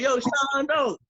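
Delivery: loud call from afar; shouted greeting across the street. Retold or imitated. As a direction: yell it down the block to your son. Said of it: "He'd be like".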